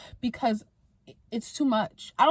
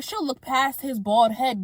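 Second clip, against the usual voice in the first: usual speaking voice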